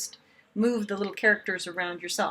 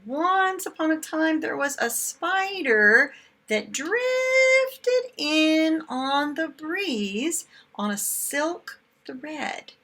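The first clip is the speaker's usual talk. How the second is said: slow, lilting read-aloud voice; storybook reading; 7 semitones higher, 6 dB louder, slower than usual. A narrator's reading. Teacher reading the opening line to her class, lilting and slow. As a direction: read it like opening a picture book.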